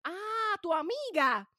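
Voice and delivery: high-pitched mock recognition; oblivious relative. Relative meets her girlfriend, high oblivious mock delight. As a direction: swoop up high on a feigned realisation.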